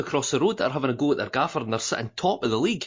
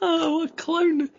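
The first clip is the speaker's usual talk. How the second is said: high, laughing put-down of a friend. Real, not acted. Mocking co-host who botched the website name, laughing. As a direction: crack up mid-tease.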